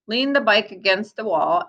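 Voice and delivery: ordinary delivery, nothing marked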